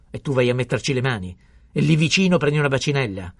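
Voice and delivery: loud, high, incredulous rebuke; outraged scolding. A narrator's reading. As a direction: raise your voice in indignant disbelief. Pharmacist rages on at the boy. (rimprovero indignato, urlato)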